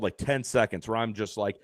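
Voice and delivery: ordinary talk, nothing marked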